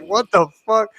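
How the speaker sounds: high-pitched mock bewilderment; retold disbelief. Retelling own reaction to the accents, mock disbelief. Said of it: "I was like".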